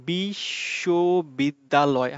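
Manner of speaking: বিশ্ববিদ্যালয় drawn out very slowly, pausing between each syllable. Shown as a demonstration. Teacher showing students the syllables, slow and broken up. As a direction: break বিশ্ববিদ্যালয় into its syllables with small stops. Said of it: "থেমে থেমে উচ্চারণ করতেছি".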